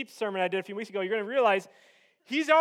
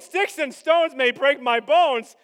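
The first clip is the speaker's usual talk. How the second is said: fired-up exclamation; 2 semitones higher, 9 dB louder, slower than usual. Acted. Preacher riffing on Goliath's insults, fired-up exclamation. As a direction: punch it out big and slow.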